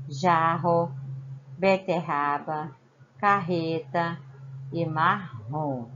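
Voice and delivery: Jarro, beterraba, carreta e marrom, slow, with a throaty rr; strong double R. Shown as a demonstration. Teacher reading the double R words slowly for pupils. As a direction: draw out each rr strongly. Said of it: "Olha como o som saiu forte".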